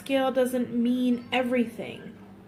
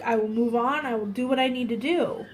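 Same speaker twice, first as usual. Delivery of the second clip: ordinary talk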